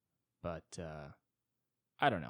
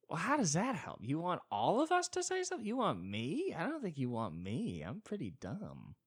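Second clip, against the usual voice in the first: much higher, mocking disbelief; mock incredulous; 8 semitones higher than usual. Answering a young person online, mock incredulous and much higher. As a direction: pitch way up, sarcastically incredulous.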